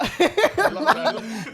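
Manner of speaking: big burst of loud laughter; loud hearty laugh. Real, not acted. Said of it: "That was a great laugh".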